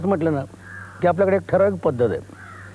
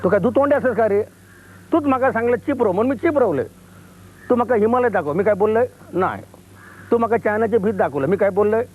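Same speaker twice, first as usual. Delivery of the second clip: higher scolding outburst, jabbing each question; mother flaring up; 7 semitones higher than usual. Retold or imitated. Silenced mother finally scolds her pilot son, indignant outburst. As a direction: let the bottled-up anger burst out at him. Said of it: "आई जी भडकते".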